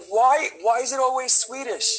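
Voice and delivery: high-pitched mock indignation; pissed-off Norwegians. Actor imitating Norwegians passed over for Swedes, indignant. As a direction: complain way up high, hammed-up annoyance. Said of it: "so many Norwegians that are pissed".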